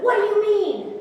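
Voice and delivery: loud, high indignant retort; angry retort. Retold or imitated. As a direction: imitate an outraged husband firing back. Husband's angry retort in acted quarrel.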